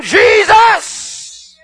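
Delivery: a preacher voicing a mother's cry for help; mock scream. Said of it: "She literally screams to him".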